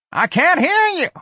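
very high, loud squawk; old woman's cry. Acted. Miss Trixie grabbing his arm over the singing, crying out. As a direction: cry out at the top of the range. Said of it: "Miss Trixie cried".

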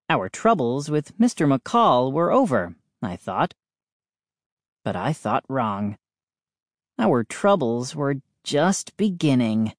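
plain reading voice

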